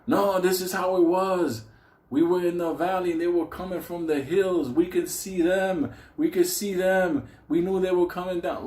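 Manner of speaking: lost in a war memory; hint of a haunted old soldier; veteran reliving war.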